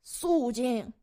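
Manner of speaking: sharp official command; courtroom call for order. Acted. Bishop calls court to order.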